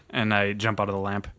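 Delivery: ordinary delivery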